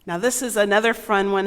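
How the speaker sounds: usual speaking voice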